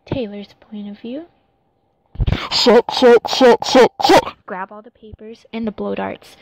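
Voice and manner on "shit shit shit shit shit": loud panicked swearing; panicked yelling. Acted. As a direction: shout it in panic. Panic in the cave.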